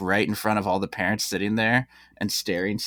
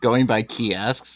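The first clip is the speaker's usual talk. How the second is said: normal talking voice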